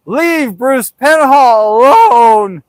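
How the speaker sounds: hammed-up slow holler; mock calling out. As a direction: call her off, stretching the words slowly. Retold calling off his sister.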